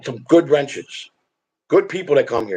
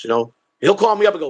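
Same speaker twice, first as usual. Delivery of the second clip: ordinary talk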